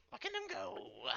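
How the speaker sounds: distant high chirp. Acted. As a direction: call it from far behind. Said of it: "Calcumon from the back".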